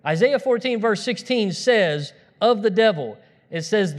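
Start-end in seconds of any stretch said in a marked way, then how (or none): none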